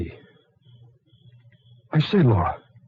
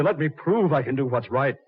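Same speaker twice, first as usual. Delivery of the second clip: ordinary talk, nothing marked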